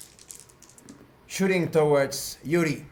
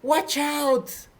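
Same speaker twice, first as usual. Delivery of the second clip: yelled warning; 11 semitones higher than usual. Acted. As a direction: call out an urgent warning, pitched way up. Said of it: "Arisa yells".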